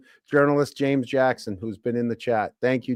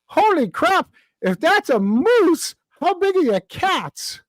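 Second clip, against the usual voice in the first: high, slow, astonished Irishman; badly faked Irish punchline, pitched way up; bad Irish accent; 14 semitones higher, slower than usual. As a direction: draw out a hammed-up Irish accent. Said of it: "I can't do accents".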